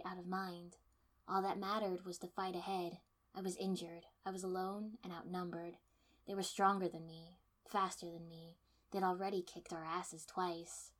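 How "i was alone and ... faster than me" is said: ordinary delivery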